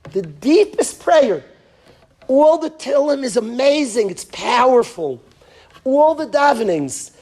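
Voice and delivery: high, loud, fervent preaching; impassioned preaching. Acted. Rabbi preaching to parents, fervent and loud. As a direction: raise the voice and preach with fire.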